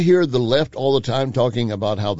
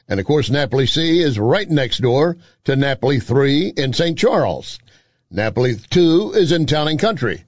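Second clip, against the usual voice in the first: live ad read; 3 semitones higher, 3 dB louder than usual. Acted. Host reads sponsor endorsement.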